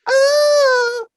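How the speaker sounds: high, loud mock moaning; fake porn moans. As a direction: ham up a porn moan. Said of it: "sound like porn".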